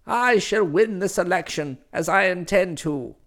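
stern domineering matron; formidable aunt voice. Acted. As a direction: lecture him like a severe aunt. Same candidate continues.

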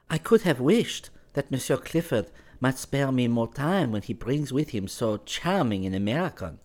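high, light woman's voice, cool and teasing; young Parisian woman. Acted. Valentine asks Clifford why he is not at Versailles, in a high, teasing woman's voice. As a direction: play her as poised and a little mocking.

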